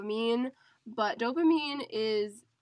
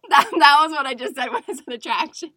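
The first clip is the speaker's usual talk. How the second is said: laughing; 4 semitones higher, 14 dB louder, faster than usual. Real, not acted. Laughing about the sultry voice.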